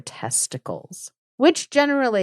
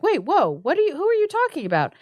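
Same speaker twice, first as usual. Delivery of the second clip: high-pitched baffled questioning; acted startled bewilderment; mock confusion; 9 semitones higher, much faster than usual. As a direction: stumble into incredulous questions. Husband's confused reply, acted.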